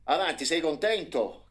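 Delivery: high, loud irritated question; father demanding if the boy is happy now; exasperated demand. A narrator's reading. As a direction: ask it fed up. Father snapping at son.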